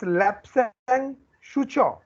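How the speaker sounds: Lapsang Souchong drawn out very slowly, word by word; pronunciation model. Shown as a demonstration. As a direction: stretch "Lapsang Souchong" for learners to copy. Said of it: "Who will tell me the pronunciation". ("Lapsang Souchong" का बहुत धीमा उच्चारण)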